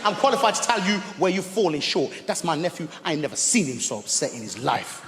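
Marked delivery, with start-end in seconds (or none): 0.0-2.1 raised, scolding voice; puffed-up uncle lecturing the teacher; mock scolding shout.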